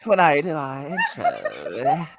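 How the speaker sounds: put-on drawn-out British accent through laughter; mock British accent. Co-host mimicking her new married intro voice, mock British. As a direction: tease with a slow, hammed-up British lilt. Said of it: "you damn near British".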